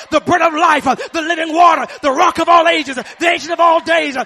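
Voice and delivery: loud, driving preacher's shout; preaching at full force. Acted. Preacher rallying the church against the devil, shouting. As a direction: hammer it home to the congregation.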